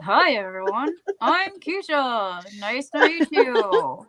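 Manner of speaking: ventriloquist doll voice, teeth clenched; through gritted teeth. Pretend. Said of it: "talk to, through my teeth like this".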